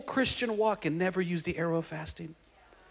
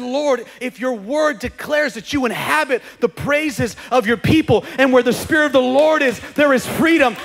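fervent prayer; 5 semitones higher, 8 dB louder than usual. Retold or imitated. Preacher voicing believer's prayer in fervent rising shout. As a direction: pray it out with full conviction. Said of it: "used your arrow of worship and said".

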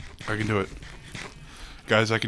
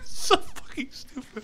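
high shrieking laugh, words trailing softer; helpless laughter; 13 semitones higher, 3 dB quieter than usual. Real, not acted. Gamer finding it so stupid, laughing hard. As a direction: barely get it out through laughter.

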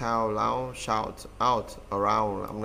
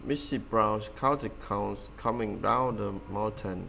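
slow, careful reading of the sentence; slow model sentence; slower than usual. Shown as a demonstration. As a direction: read it slowly, word by word. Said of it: "Missus Brown count". (đọc chậm, rõ từng chữ)

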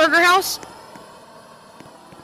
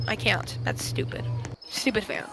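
whispering while hiding; 6 semitones lower, 12 dB quieter than usual. Real, not acted. I'm hiding in the closet.

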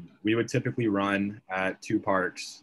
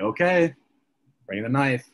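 alarmed okay; 5 semitones higher, 4 dB louder than usual. Retold or imitated. Alarmed reply quoted.

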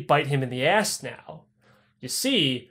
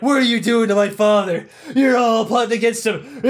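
put-on drunken accusing shout; 5 semitones higher, 4 dB louder than usual. Drunk son barging in.

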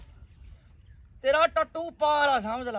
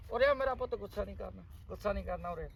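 softer, lower soothing call; coaxing; 6 semitones lower, 8 dB quieter, faster than usual. Acted. Scene: father softly coaxing his sulking son to come over, soothing. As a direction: coax him like calming a child.

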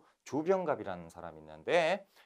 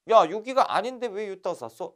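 official scolding; 8 semitones higher, 9 dB louder than usual. Retold or imitated. Official scolds peasants building dam.

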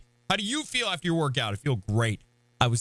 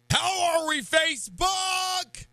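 hype shout; 11 semitones higher, slower than usual. Real, not acted. Hyping up the stream audience.

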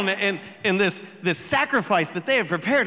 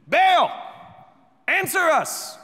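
high, raised cry to a god; shouted plea; 9 semitones higher, 3 dB louder, slower than usual. A narrator's reading. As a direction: call out loudly for an answer. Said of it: "they shouted".

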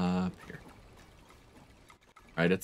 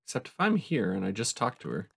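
usual speaking voice

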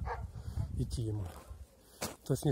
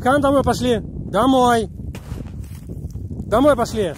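loud, high call to a dog; 9 semitones higher, 10 dB louder than usual. Real, not acted. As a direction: shout the dog's name across the yard. Calling the dog home outdoors.

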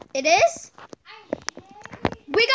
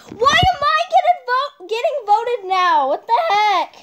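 whiny protest; 5 semitones higher, 3 dB louder than usual. Real, not acted. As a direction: wail it like it's unfair. Gets voted out in game.

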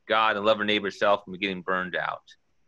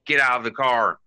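loud, high imitation of an angry demand; mock shouted order; retold yell; 5 semitones higher, 7 dB louder than usual. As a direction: act out her yelling at the driver. Acting woman banging on the window.

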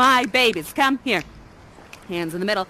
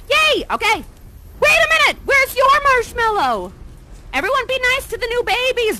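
excited host; 12 semitones higher than usual. Acted. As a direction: bubble over with energy. Excited hosting of marshmallow party.

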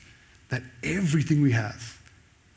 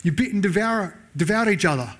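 sarcastic; 5 semitones higher than usual. Retold or imitated.

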